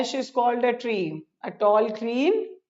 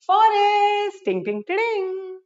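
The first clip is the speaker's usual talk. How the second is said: playful singsong; 9 semitones higher, faster than usual. Pretend. Teacher revealing the answer to children, high singsong. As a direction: chime it out high. Answer given with jingle sound.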